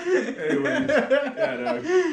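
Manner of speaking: high sign-off through lingering laughter; laughing. Real, not acted. Host closing episode five to co-host, still laughing. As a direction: try to wrap up while cracking up. Cracking up while wrapping up.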